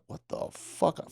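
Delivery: breathless, soft, slow what the fuck; whispered disbelief. Retold or imitated. Recruit reacting to instructor's threat, soft slow whisper. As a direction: mouth it almost silently in shock.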